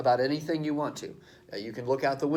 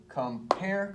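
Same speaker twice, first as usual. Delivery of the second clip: "compare" slowly split, weight on "pare"; iambic stress; 6 semitones higher than usual. Shown as a demonstration. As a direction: draw out the second syllable of "compare". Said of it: "the accent is on the second syllable".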